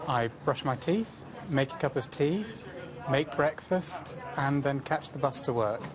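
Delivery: ordinary delivery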